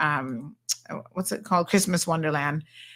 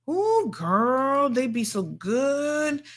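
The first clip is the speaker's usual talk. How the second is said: savoring; 6 semitones higher, much slower than usual. Real, not acted. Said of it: "savoring bread rolls".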